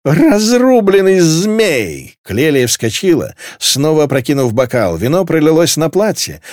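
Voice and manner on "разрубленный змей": high, very drawn-out curse; drunken outcry. A narrator's reading. Drunk girl jumps up cursing, loud and stretched. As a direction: howl the oath tipsily.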